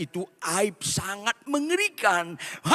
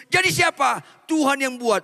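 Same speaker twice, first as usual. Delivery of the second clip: shouting father; 7 semitones higher than usual. Acted. Father shouting at Maria over her answer. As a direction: shout in disbelief.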